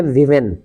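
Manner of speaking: "विमेन" with its o said as short i; short i sound. Shown as a demonstration. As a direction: say "विमेन" with a short i in the first syllable. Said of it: "छोटी ई के रूप में भी उच्चारण होता है". ("विमेन" में छोटी ई की आवाज़)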